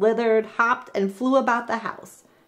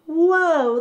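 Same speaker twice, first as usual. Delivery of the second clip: high, loud gasp of wonder; wide-eyed discovery; surprised whoa; amazed whoa; 8 semitones higher, 5 dB louder than usual. Acted. Katie sees result.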